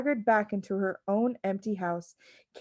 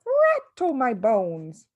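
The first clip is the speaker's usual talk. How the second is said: spooky parrot voice; 4 semitones higher, 5 dB louder, much slower than usual. Acted. Parrot repeats its phrase.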